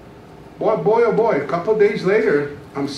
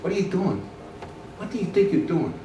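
the same inner voice; 7 dB quieter than usual. Retold or imitated. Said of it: "that same voice came to me".

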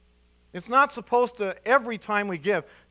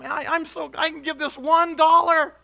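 high, louder, delighted; joyful giver; 8 semitones higher, 7 dB louder than usual. Retold or imitated. Preacher acting a delighted giver, high and loud. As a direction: gush like a happy giver.

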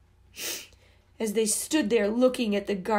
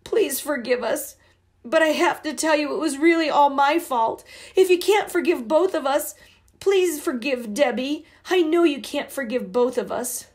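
put-on higher, loud pleading girl near tears; tearful pleading; 5 semitones higher, 4 dB louder than usual. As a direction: plead through held-back sobs. Babs choked back a sob.

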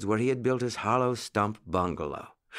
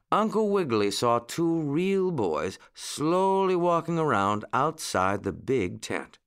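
ordinary delivery, nothing marked

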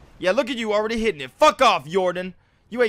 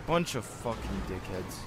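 very low, quiet cursing; soft, low, sullen insult; muttered grumble; 14 dB quieter than usual. Real, not acted. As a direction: grumble it under your breath. Grumbles at other players.

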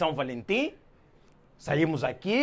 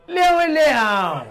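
emphatic; 11 semitones higher, 9 dB louder, slower than usual. Acted. Comic insisting on the lion.

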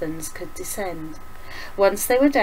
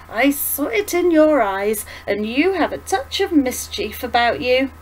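put-on slightly raised, lively old woman's voice; louder, higher, knowingly amused; lightly voiced old woman; 5 semitones higher, 6 dB louder than usual. Old woman tells Violet what she saw in her, knowingly.

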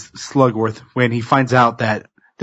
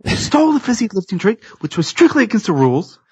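Wonka yelling; 10 semitones higher than usual. Retold or imitated. Candy maker yelling at the grandfather, high outraged shout. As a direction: throw him out with a furious good day. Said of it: "Wonka starts yelling at Grandpa Joe".

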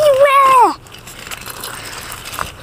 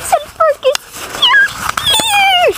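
excited greeting; 9 semitones higher, slower than usual. Acted. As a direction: call out in delight at his friend. Character delighted to see friend.

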